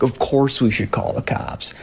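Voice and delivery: whispered, hiding from the intruder; hushed whisper. Retold or imitated. Roommate whispering back, scared the intruder will hear. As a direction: breathe it out with no voice.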